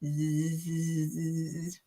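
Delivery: long low buzzing bee; quiet low bee imitation. Shown as a demonstration. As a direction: hum out a soft, sustained buzz. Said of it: "A bee".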